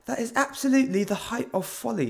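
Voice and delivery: high mock British put-down, lightly voiced. Jokey British accent mocking a word from the manga. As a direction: toff British accent, sneering, a light imitation. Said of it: "something a British guy would say to you".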